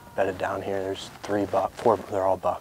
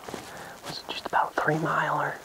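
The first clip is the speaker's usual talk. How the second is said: wildlife watching whisper; 7 semitones higher than usual. Real, not acted. Whispered debrief.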